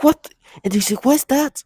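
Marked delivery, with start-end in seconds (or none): 0.0-0.3 mock alarm. High alarmed retold question. 1.0-1.6 high, mock-alarmed question; fake surprise. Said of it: "you try to act like".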